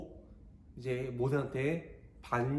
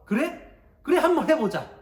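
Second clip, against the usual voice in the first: high, brash dare; cocky acceptance; 9 semitones higher, 7 dB louder than usual. Acted. Korah accepting Moses's challenge, high and cocky. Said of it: "그렇게 이야기하는 거예요".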